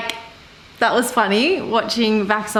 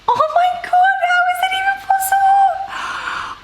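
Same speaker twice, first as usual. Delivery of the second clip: squealing excitement. Real, not acted. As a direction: shriek with delight at the puppy. Seeing puppy video.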